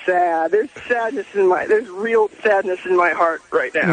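low, downcast confession; sad. Real, not acted. Caller admits he missed his idol, sad. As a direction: let the pitch sink with regret. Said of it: "I feel sad".

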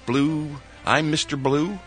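ordinary talk, nothing marked

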